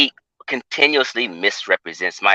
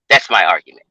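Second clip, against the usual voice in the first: normal talking voice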